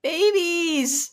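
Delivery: high squeal of delight; squealing babies. Real, not acted. Hosts squealing over the couple as babies.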